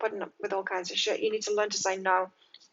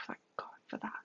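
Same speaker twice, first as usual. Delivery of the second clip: breathless whisper of relief; 17 dB quieter, faster than usual. Retold or imitated. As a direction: whisper it like a grateful secret. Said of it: "you're so grateful for it that you're like".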